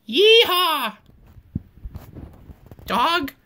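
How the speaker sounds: loud hammed-up yeehaw whoop; mock cowboy yell. Teacher voicing the South at Gettysburg, loud mock whoop. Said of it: "and the South was like".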